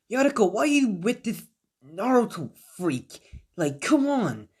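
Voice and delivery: put-on high, scornful mean-girl voice; snooty Sakura. Sakura scoffing at Yamato over Naruto, high and snooty.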